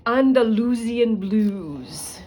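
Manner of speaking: put-on slow, loud, grand Romanian accent on Andalusian blues; imitating the professor's Romanian accent, savoring it. Recalling her genetics professor's phrase, in Romanian accent. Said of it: "in her words and accent".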